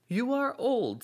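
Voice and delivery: put-on young man voice. A narrator's reading. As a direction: raise the pitch for the youth.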